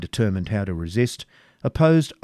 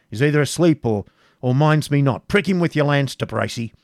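loud, raised commanding voice; impatient prince; 5 semitones higher, 5 dB louder than usual. A narrator's reading. Impatient prince ordering his knight to prick the Saxon, loud command. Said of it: "But the impatient John".

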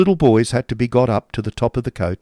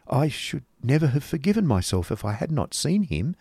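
high, lilting motherly voice; fretting mother; 5 semitones higher than usual. Acted. Mother seeing off her son, fretting. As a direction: swoop the pitch up and down, fretting. Voicing Mrs Nickleby.

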